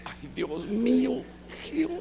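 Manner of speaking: high, slow worried groan; anxious sigh. Retold or imitated. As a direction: dread what comes next. His fearful reaction to summons.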